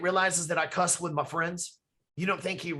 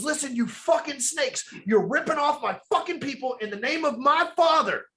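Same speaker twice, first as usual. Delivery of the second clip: higher, louder enraged scolding; furious Jesus; 9 semitones higher, 4 dB louder than usual. Retold or imitated. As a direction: tear into the money changers in the temple. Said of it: "flipped that table over and goes".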